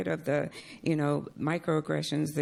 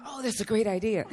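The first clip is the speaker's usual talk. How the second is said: higher, gushing imitation of approval; exaggerated admiring reaction; mock enthusiasm; 7 semitones higher than usual. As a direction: imitate a room suddenly impressed. Everybody praises the man's idea.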